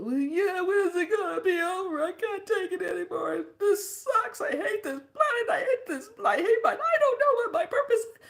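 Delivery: high wail with laughing sobs; crying mess. Retold or imitated. To the elder, crying she can't take it. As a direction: cry and laugh out the despair. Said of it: "I was just a crying mess".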